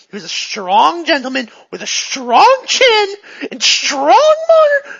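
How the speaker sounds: hammed-up impassioned outburst, climbing loud and high; worked up. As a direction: get carried away, stretching the last words. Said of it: "So she gets back into it".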